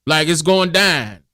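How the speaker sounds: put-on slow, drawn-out Houston accent. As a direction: lay on a lazy Houston accent. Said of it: "I could tell a person from H-Town 'cause of their accent".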